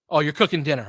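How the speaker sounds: ordinary delivery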